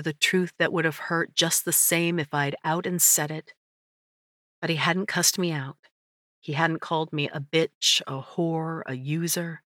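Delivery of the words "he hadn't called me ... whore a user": usual speaking voice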